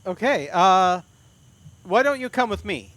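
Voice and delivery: the guard waves him along. Acted. GM voices the guard.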